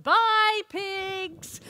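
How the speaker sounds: high loud farewell call over the shoulder; gleeful call. Acted. Hero whooshing past the pigs, high loud taunting call. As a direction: fling it back at the pigs.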